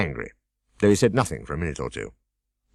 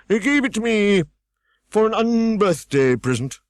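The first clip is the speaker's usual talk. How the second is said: higher, louder pompous scold; Humpty Dumpty scolding; 14 semitones higher, 5 dB louder, slower than usual. A narrator's reading. Humpty Dumpty scolding Alice, higher and louder. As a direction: snap at the girl from the wall.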